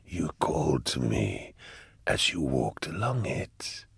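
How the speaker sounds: Cherubael. A narrator's reading. Cherubael answers the man who summoned him, slow and eerie.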